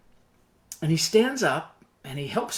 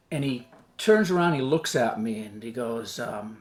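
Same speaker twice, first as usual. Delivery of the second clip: normal talking voice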